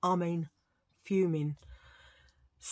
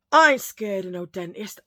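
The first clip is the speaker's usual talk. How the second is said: bold boast; 8 dB louder than usual. Acted. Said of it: "repeats the boast three ways".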